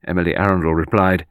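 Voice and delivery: ordinary delivery, nothing marked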